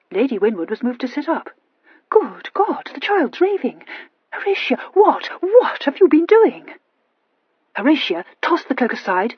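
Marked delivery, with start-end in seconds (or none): 2.1-6.7 alarmed. A narrator's reading. Mother alarmed.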